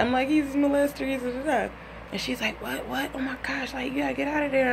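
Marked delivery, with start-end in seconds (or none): 0.3-1.7 high, whiny tearful report; retold crying. 2.6-4.7 alarmed friend. Retold or imitated. Friend alarmed on the phone, retold.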